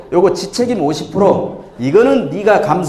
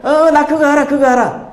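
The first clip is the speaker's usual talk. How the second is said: higher, louder blurting out; eager interrupter; 10 semitones higher, 4 dB louder than usual. Acted. Audience member cutting off a joke they know, eager interruption. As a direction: jump in like a listener who already knows. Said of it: "무슨 얘기 하려고 그러니까".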